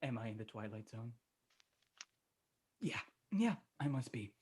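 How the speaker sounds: very soft, low musing to oneself; hushed, bewildered self-talk; dazed muttering. Acted. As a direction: mutter it in quiet disbelief.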